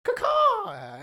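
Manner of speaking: high squawking caw; bird call. Retold or imitated. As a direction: screech like a crow. Witch turns into bird.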